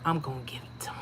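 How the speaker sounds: mischievous whisper to the viewers; whispered aside. Real, not acted. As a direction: let it slip out in a whisper.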